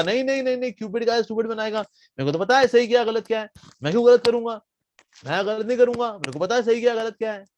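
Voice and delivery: high, cocky mimicry; mocking imitation. Vyasa mocking Jaimini's earlier boast in a high voice. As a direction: parrot his boast in a high, silly voice.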